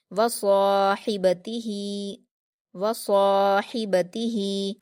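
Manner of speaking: वसाहिबतिही slowly, long heavy सा, throaty हि, long final ही; stretched, full sad. Shown as a demonstration. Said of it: "दो हरकत खींचकर पढ़ेंगे".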